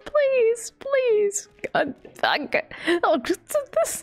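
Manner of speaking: high-pitched frantic begging to stop; panicked high pleading. Real, not acted. Streamer pelted by viewers, frantic high-pitched pleading. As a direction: plead with the chat to stop throwing things, voice cracking high.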